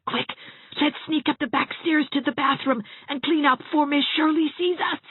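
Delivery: a lightly voiced breathless girl; hushed urgent plotting; urgent child. A narrator's reading. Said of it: "gasped Geraldine".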